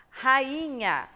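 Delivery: Rainha said slow and loud with the a and i apart; a Rainha dividida em ra-i-nha; syllables split. Shown as a demonstration. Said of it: "o A é uma, uma vogal, o I outra".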